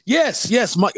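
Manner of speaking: ordinary talk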